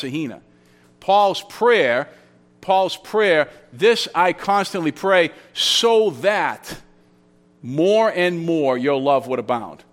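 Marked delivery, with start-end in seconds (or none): 3.7-9.8 slow, with "so that" held out before a pause; deliberate reading, pausing after "so that"; emphatic reading. Shown as a demonstration. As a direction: set off "so that", then weight "more and more". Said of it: "That's the way to read that".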